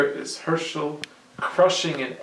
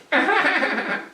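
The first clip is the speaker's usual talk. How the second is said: high, loud mocking goblin cackle; goblin laugh; 6 dB louder than usual. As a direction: laugh off the idea. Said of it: "The goblin laughed".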